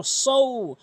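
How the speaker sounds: সৌ with a heavy s and a long gliding ou; held, slow সৌ; pronunciation demo. Shown as a demonstration. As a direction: draw out the diphthong of সৌ slowly. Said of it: "এভাবে উচ্চারণ করবেন".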